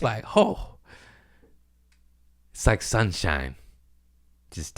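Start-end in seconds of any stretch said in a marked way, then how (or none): 0.4-0.7 high, wide-eyed little oh of wonder; a sudden high oh of amazement; awed gasp. Acted. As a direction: let it slip out, dazzled. Awestruck at seeing woman naked.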